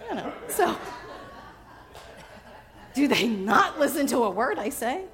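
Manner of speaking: usual speaking voice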